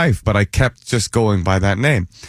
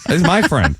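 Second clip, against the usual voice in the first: ordinary delivery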